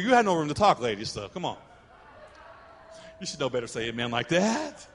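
normal talking voice

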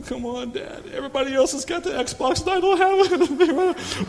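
mock child whine; whiny child. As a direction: pout like a left-out kid.

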